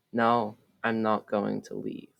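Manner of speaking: ordinary delivery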